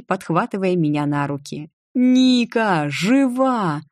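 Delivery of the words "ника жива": joyful shout. A narrator's reading. Denis overjoyed, still shouting.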